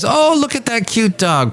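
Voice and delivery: high-pitched imitation of a delighted woman; cooing lady. Lady pointing at the narrator's dog, cooing.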